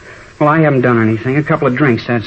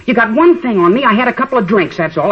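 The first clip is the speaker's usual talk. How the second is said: higher, heated protest; defiant outburst; 10 semitones higher than usual. Acted. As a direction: lash out at the cops. Teen defies police in interrogation.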